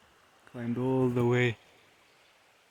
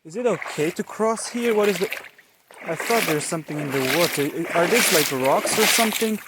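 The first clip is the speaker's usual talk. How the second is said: nervous; 4 semitones higher than usual. Real, not acted. Nervous crossing of the pond.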